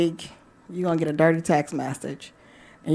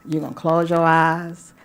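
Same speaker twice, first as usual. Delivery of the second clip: ordinary talk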